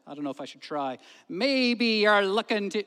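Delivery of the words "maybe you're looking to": broad Essex, loud and drawn out; attempted Essex accent. Lay preacher pointing at his hearers, attempted Essex accent. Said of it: "he said in a broad Essex accent".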